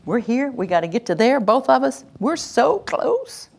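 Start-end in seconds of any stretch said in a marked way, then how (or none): 2.2-3.5 soothing coax. Shown as a demonstration. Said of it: "coaxing child toward calm".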